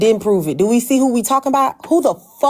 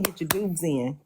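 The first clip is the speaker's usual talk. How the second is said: soft fading repeat; 8 semitones lower, 12 dB quieter than usual. Shown as a demonstration. Host reminding chat about dues, softly trailing off. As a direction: Get your dues in, dropped much softer and lower.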